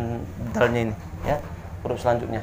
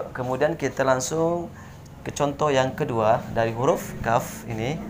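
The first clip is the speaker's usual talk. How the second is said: ordinary talk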